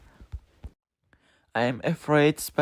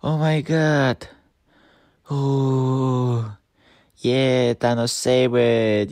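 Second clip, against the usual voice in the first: ordinary delivery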